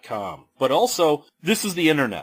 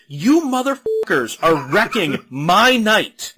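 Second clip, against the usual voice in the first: fed up; 5 semitones higher, 6 dB louder than usual. Retold or imitated. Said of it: "I'm just sitting here like".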